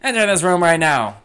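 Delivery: high, loud, hammed-up outraged father; indignant fifties sitcom dad shouting across the house; fifties standard dad. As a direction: play the blustering fifties dad calling for his wife. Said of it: "the fifties trope of the standard dad".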